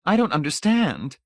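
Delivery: high-pitched, troubled lady. A narrator's reading. Missus Pritchett cannot follow Hamilton's reasoning, troubled. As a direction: plead confusion, pitched well up.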